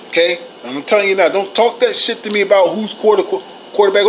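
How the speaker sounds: ordinary talk, nothing marked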